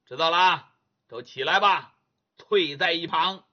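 loud, curt order from a haughty official; dismissive judge. Acted. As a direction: wave the lackeys off with disdain. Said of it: "把嘴一撇".

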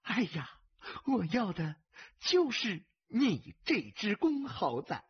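delighted, silly-sweet woman's tease; giddy concubine. A narrator's reading. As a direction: draw it out with doting delight. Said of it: "痴痴地笑着".

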